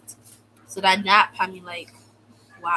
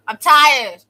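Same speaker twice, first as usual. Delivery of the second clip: loud, higher, worn-out cry; fed up; 5 semitones higher, 10 dB louder than usual. Real, not acted. Venting to viewers about detention, a loud fed-up cry. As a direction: shout the tiredness out. Repeating the complaint.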